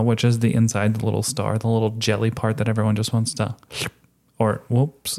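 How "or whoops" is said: slurping while talking. Real, not acted. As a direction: slurp mid-sentence, then catch it. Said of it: "you better stop slurping".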